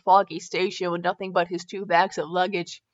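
plain reading voice

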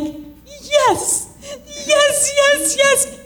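high, eager repeated yes; thrilled high squeal of agreement; excited yes. Retold or imitated. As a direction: gush yes like you can't contain it. Excited answer to the mom.

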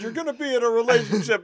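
high, fast put-on voice; mock studio boss. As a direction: rattle it off like an order. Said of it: "they said".

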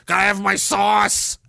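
slow, put-on needy voice; mocking sauce lover. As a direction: whine it like a spoiled eater.

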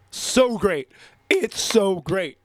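gleeful gloat. Real, not acted. Host crowing over the Eagles' Super Bowl loss. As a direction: crow with delight.

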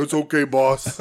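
a touch of Andre's comforting voice, done lightly; Jake voice. Retold or imitated. Jake quoting Andre to the fans, lightly imitated. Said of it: "Doing the Jake voice is hard".

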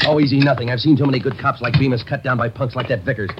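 angry. Acted. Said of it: "Getting mad won't help".

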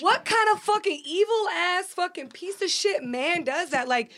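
ranting about the ex, furious and near tears; furious crying rant. Real, not acted. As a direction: rage at him through tears.

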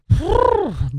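high, noisy exasperated sigh; exasperated exhale. Real, not acted. Fed up with his annoying cat, exasperated sigh. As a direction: blow out a long fed-up breath.

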